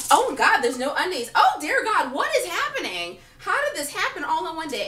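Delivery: high, loud hammed-up dismay; mock panic. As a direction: exclaim in comic horror, pitch jumping up. Acts out shock at missing underwear.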